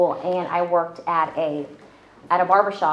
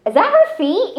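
imitating her dad, much higher and louder; mock dad spotting her feet; 12 semitones higher, 4 dB louder than usual. Dad spotting his hiding daughter's feet, hammed-up high voice. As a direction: squeak it out like a dad who just spotted her under the table. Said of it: "And he's like".

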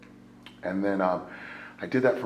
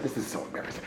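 whisper; 13 dB quieter, much faster than usual. Retold or imitated. Ashamed before the officer.

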